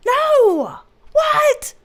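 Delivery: very high, drawn-out exclaiming; high-pitched astonished cry; amused shock. Real, not acted. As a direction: squeal it in disbelief. Mock shock at flesh colour.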